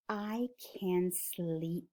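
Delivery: very slow, soft murmur; sleepy relief. Acted. Farmer relieved the animals are quiet, slow soft voice. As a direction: say it slowly and softly, settling to sleep.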